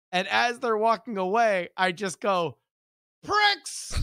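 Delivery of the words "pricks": high-pitched mock yell; hammed-up shouted insult; childish taunt. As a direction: call it after bullies in a high kid voice. Child yelling at bullies.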